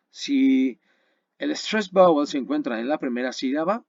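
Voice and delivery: usual speaking voice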